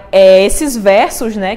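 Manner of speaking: usual speaking voice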